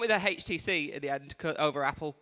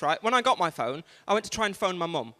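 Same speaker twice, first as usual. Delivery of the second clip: usual speaking voice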